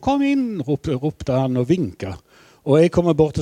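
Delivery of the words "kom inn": high called-out come in, imitating the king; king calling come in. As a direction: call it out like the old king. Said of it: "kongen roper". (etterligner kongen som roper kom inn)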